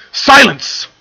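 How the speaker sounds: high, very loud command; furious roar. A narrator's reading. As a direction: roar it out to cut her off. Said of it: "Luna roared out".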